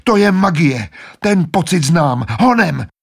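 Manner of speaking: rally them urgently; urgent shouting. Acted. Edmund urging the others. (Edmund naléhavě volá na ostatní)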